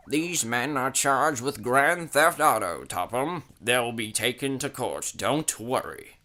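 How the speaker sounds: a hint of a stern officer; lightly voiced policeman. A narrator's reading. Policeman reporting charges to Topham, official.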